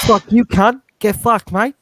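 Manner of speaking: high, loud bogan impression that sounds London Chinese; botched bogan accent. Friend mimicking Bogan for laughs, high mangled accent. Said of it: "a London Chinese type accent".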